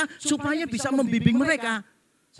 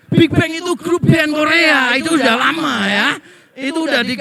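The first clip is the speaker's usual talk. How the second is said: loud amused correction; 4 semitones higher, 8 dB louder than usual. Real, not acted. Laughing correction of parents.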